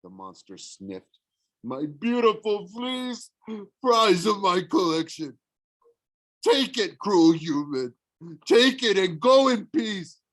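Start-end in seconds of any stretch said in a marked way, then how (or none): 1.6-10.2 tearful Cyclops. A narrator's reading. Sniffling Cyclops gives up fleece.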